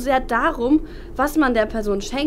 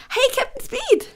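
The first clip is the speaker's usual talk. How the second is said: calling out; 9 semitones higher than usual. Acted. Ruft Freund von weitem.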